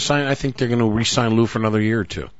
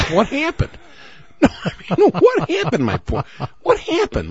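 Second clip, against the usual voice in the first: squeaky, laughing what happened; high teasing disbelief; 14 semitones higher than usual. Real, not acted. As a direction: pitch it up in playful shock.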